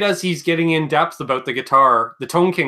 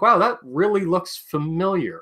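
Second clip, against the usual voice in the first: acted amazement; 5 semitones higher than usual. Acted reaction to a photo.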